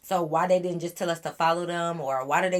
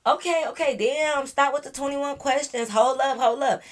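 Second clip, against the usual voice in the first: much higher, mock-flustered voice; mimicking defensive guys; 8 semitones higher, 4 dB louder than usual. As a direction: ham up the guys backing off. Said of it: "They just like".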